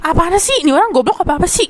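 high-pitched retold exasperation; exasperated outburst. Candidate fed up with interviewer's questions, acted exasperation. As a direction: blurt it out in mock frustration. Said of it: "gue kayak udah gregetan banget".

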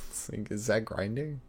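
usual speaking voice

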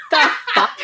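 breathy laughing the fuck; laughing disbelief. Real, not acted.

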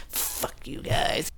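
slow, low mock whisper; hushed, drawn-out playful dig; joking whisper. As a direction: whisper the insult slowly as a joke. Joking sign-off after thanks.

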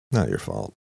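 low, offhand shrug of a line. A narrator's reading. Jack shrugs off the apology.

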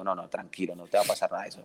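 soft, calming reassurance; reassuring. Retold or imitated. Trainer calming a nervous dog owner, soft and reassuring. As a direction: settle a worried owner gently.

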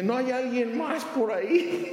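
high, pleading comic whine; high whiny punchline. Acted. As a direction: ask hopefully for someone else. Punchline, man asks for another.

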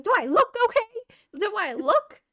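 high, loud, disbelieving inner retort; incredulous outburst. Retold or imitated. To the dog owner asking if she's okay, high incredulous yell. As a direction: fire it back in disbelief, voice shooting up.

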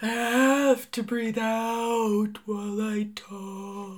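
very slow, breathy whale voice. Pretend. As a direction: exhale every word like a whale. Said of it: "in that whale voice".

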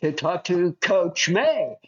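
slow sing-song pitch. Pretend. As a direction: stretch each word like a game-show host. Playful coach impression, drawn out.

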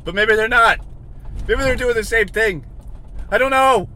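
high, loud frustrated shouting; screaming rant. Real, not acted. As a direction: shout it out, climbing in exasperation. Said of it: "you gotta listen to me scream".

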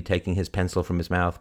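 plain reading voice

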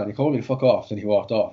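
ordinary delivery

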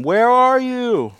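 high, loud, drawn-out call across the garden. Acted. God calling out to the hiding Adam and Eve, loud and high. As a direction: call out slowly to someone hidden.

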